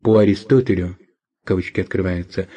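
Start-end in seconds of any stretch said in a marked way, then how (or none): 1.4-2.5 quiet aside for the quote marks; dictating. Real, not acted. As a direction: read the punctuation out softly. Reads out quote marks aloud. (диктовка вполголоса)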